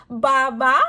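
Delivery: loud, playful sheep baa; sheep bleating. Shown as a demonstration. Teacher answering what a sheep says, bleating loudly. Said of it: "says the sheep".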